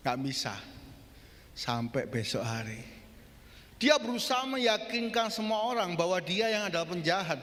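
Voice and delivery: ordinary talk, nothing marked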